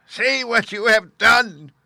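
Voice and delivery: very high, loud, slow shriek; witch screaming. A narrator's reading. Melting witch screaming at Dorothy. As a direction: scream at her in shock. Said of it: "she screamed".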